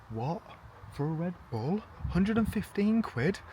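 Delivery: incredulous, rising in pitch; mock disbelief. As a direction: question the price in rising astonishment. Acts shock at a price.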